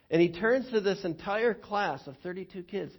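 normal talking voice

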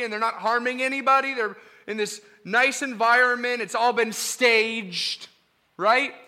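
lion keeper sternly correcting the visitor; stern warning. Acted. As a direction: warn the visitor firmly. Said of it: "the lion keeper ... says".

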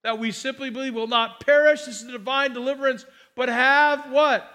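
loud, driving pulpit delivery; preaching at full force. Acted. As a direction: proclaim it to the whole church. Preacher building to a climax.

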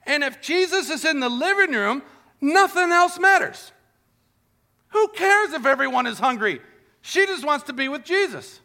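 fervent preaching. Acted. Preaching builds to a climax.

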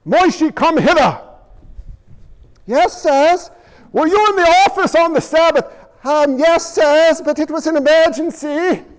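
lightly voiced dialogue between elders and Moshe; acted temple scene. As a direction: play the stern elders and the sheepish Moshe. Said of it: "That's how they ran".

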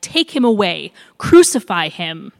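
loud, forceful repeated demand; crowd shout. A narrator's reading. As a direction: hit each demand hard, as a shouting crowd. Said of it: "they shouted".

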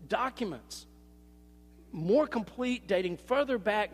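ordinary talk

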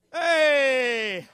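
long, loud drawn-out hey; sudden greeting. Acted. Running boy suddenly finds his parents, loud drawn-out greeting. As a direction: stretch out a loud, casual-seeming hey.

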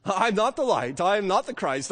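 ordinary talk, nothing marked

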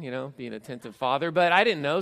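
normal talking voice

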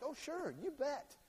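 high, easy yes that means nothing; breezy fake agreement. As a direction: toss off a hollow yes. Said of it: "he's like".

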